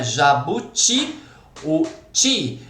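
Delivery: Jabuti drawn out slowly, final TI standing out; stress on last syllable. Shown as a demonstration. Teacher showing which syllable stands out, last-syllable stress. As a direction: make the TI of Jabuti ring out, then repeat it alone. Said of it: "Qual é a sílaba que se sobressai?".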